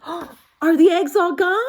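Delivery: excited Elephant; hopeful gasp. Acted. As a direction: gasp with sudden hope. Hopeful gasp then question.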